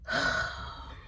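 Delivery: long, soft breath out; exasperated sigh. Real, not acted. Venting about a job that isn't enough, exasperated sigh. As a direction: sigh it out, worn down.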